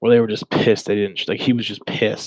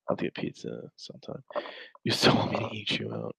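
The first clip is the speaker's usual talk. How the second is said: whispered reading; 10 dB quieter than usual. Retold or imitated. Reading predator chat aloud.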